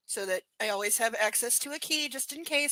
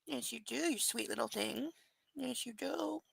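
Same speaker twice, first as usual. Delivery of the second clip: soft cooing; 10 dB quieter than usual. Real, not acted.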